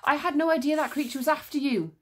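fast, high, worried voice; Encanso, anxious and quick; urgent Encanso. A narrator's reading. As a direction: rush it out in alarm. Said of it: "said Encanso".

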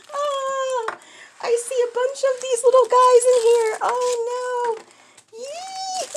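high, bright-voiced delight; excited cooing. Real, not acted. Spotting a bunch of little toys inside, cooing high.

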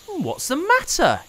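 put-on high, kindly boy asking; lightly voiced caring boy; concerned boy. A narrator's reading. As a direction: ask gently what's wrong. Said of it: "he asked".

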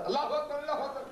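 quick clipped call pitched high; short shout. Shown as a demonstration. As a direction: bark it out fast with no melody. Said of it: "If you just shout".

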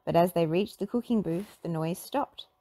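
usual speaking voice

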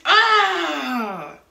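short loud angry yell; frustrated shout. Retold or imitated. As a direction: let out an angry cry. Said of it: "shouted out of anger or frustration".

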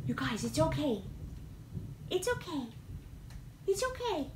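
calm the frightened kitty gently; soothing a pet. Real, not acted. Calming cats during thunder.

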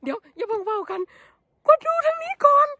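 high, loud thrilled calling; so many mushrooms she can't hold back her joy; real excitement. Real, not acted. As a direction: squeal it out, overjoyed. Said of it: "มันตื่นเต้นอีหลี".

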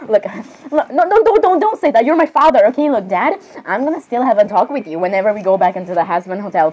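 ordinary delivery

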